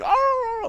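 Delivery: imitated dog yelp, very high; a sharp high yelp like a hurt dog. Dog in pain, very high yelp. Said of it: "the dog's going".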